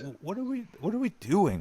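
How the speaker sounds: high-pitched, incredulous questioning; mock bewilderment. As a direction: ask in high, baffled disbelief. Exasperated rhetorical question about young recruits.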